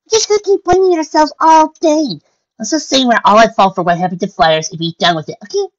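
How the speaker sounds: high, loud squeaky pony voice; high-pitched, forceful pep; exasperated Pinkie. Acted. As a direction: cut through loudly to end the blaming. Pinkie breaks up the blaming.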